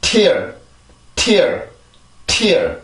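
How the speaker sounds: "tear" with crisp aspirated T, falling; English pronunciation drill. Shown as a demonstration. Teacher models the word for learners, drilled English "tear". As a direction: release the T, then glide into r on "tear". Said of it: "한 번 더요".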